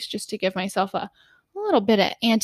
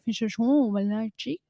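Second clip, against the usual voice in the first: put-on Recherche Biologique in a quick, light French accent; imitating French staff. Staff in the store explaining the product, light French accent. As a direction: mimic the salespeople's French accent. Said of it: "French accent stuff".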